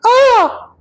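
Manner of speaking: short high-pitched shriek; acted high shriek. A narrator's reading. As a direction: let out a startled, sustained high cry. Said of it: "then he heard a shriek".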